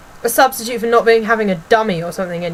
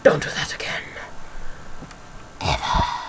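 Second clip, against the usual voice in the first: low, whispered threat; imitated menacing warning; 7 semitones lower, 8 dB quieter than usual. Imitating lady warning pupil after coat comes off, low menacing whisper. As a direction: hiss it low, ending in a deep growly whisper. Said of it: "And she's like".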